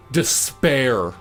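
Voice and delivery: usual speaking voice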